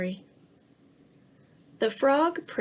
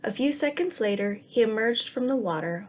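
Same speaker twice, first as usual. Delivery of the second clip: plain reading voice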